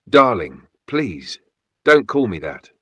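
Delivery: ordinary delivery, nothing marked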